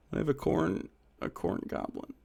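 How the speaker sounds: very soft whispered words. Real, not acted. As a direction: breathe it out as a whisper. Whispered to himself.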